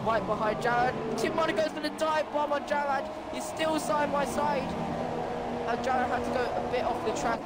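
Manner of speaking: motorsport commentator. Real, not acted. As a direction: call the race live, building energy. Live race play-by-play.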